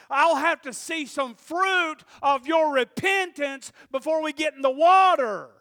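loud, slow, scolding prophet voice; John the Baptist rebuking the religious leaders, loud and slow; fiery rebuke. Retold or imitated. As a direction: hammer the snakes line like a stern prophet. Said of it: "He, he says it".

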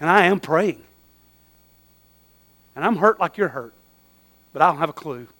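sit beside them and say it softly; tender comfort. Acted. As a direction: friend arm round someone hurting, tenderly.

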